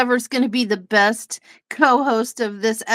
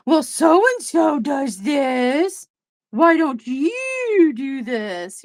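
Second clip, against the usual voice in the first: slow, higher put-on complaining voice; mock whiny commenter; 3 semitones higher, much slower than usual. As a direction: drag it out like a nagging viewer.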